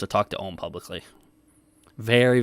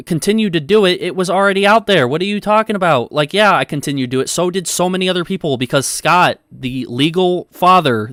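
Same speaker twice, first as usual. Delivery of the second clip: louder and higher, riled up at chat; raised voice; 5 semitones higher, 6 dB louder than usual. Real, not acted. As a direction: push back loudly at the chat. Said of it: "I raised my voice a little bit".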